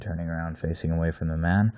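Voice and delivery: usual speaking voice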